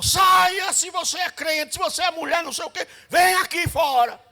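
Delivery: high, loud yell through the door; shouting. Retold or imitated. Angry neighbour yelling at the door for her to come out. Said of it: "a irmã gritou".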